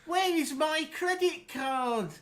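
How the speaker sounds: high, loud, drawn-out cartoon wail; hammed-up alarm. Joining a silly accent bit, hammed-up high alarm. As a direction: wail each word slowly in a high voice.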